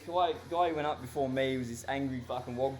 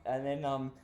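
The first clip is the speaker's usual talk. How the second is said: usual speaking voice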